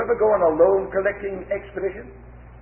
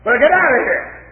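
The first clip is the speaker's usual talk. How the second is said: loud, higher angry dismissal; angry get out; 7 semitones higher, 6 dB louder than usual. Acted. Mister A throwing John out, angry shout. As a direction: bark him off the doorstep.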